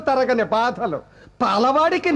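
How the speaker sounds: normal talking voice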